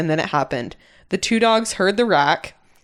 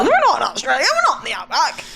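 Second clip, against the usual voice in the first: put-on Australian accent; 5 semitones higher than usual. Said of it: "I can't do an Australian accent".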